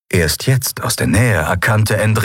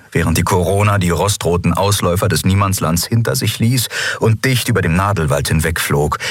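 plain reading voice

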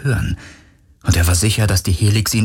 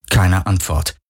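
ordinary delivery

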